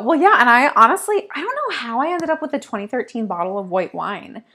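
ordinary talk, nothing marked

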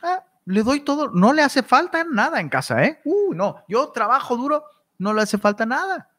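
high self-satisfied parent voice; mock smug parent. Preacher mocking a neglectful dad, high and smug.

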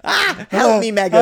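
high, loud mock scream for help; Harry screaming. Grabbed prince calling to his wife, mock terrified scream. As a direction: shriek in hammed-up terror. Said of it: "he screams".

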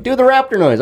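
high teasing chant; high-pitched egging on; mocking kids' voice. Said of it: "And they're like".

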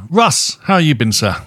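normal talking voice

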